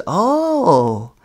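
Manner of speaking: sarcastic oh. Retold or imitated. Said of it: "And sarcastically".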